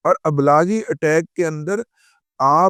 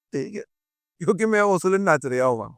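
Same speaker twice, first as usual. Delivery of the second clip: normal talking voice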